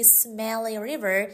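usual speaking voice

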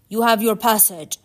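loud, forceful declaration; commanding. Acted. Charon grants Orpheus his passage, commanding. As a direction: grant it as an order.

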